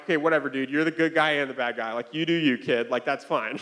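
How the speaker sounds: low, resigned mock shrug of a voice; giving up. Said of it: "I just throw in the towel".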